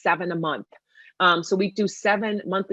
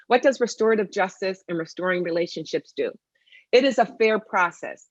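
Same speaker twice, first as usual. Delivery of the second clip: normal talking voice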